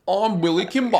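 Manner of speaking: a swallowed Midlands-ish gangster voice; Billy Kimber impression. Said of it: "He goes".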